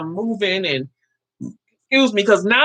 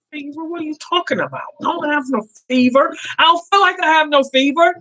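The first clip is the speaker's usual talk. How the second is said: higher-pitched protest, retold; incredulous pushback in a raised pitch; retold indignant disbelief; 7 semitones higher than usual. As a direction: act out being baffled at the very idea. Acting out her surprised protest.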